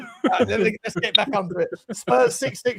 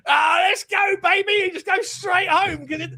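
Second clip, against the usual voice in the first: mock-excited pub pickup yell; eager acceptance; 7 semitones higher, slower than usual. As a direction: jump at the offer. Acting keen reply to woman.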